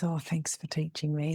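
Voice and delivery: soft unvoiced mock thank-you; whispered thanks. Trainee's quiet thanks.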